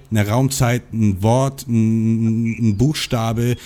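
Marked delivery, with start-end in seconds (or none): none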